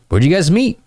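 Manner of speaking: high, eager question lifting at the end; bouncy, curious banter; playful follow-up question. Shown as a demonstration. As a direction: ask it high and interested. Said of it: "some playful banter".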